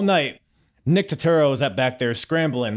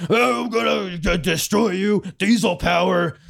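high, loud Hulk Hogan-style promo yell; imitating Diesel; 8 semitones higher, 4 dB louder than usual. Mocking Diesel fumbling his promo, high hammed-up yell. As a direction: bellow it like a cartoon wrestling promo. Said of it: "He's like".

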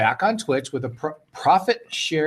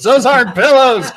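high shouted movie quote; mock shout; 10 semitones higher, 10 dB louder than usual. Host shouting a movie quote for laughs, mock shout. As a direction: belt out the famous line in mock outrage.

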